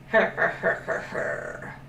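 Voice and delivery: gloating creature chuckle, fading to breath; sly toothy laugh of a crocodile. Acted. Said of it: "when the crocodile laughed".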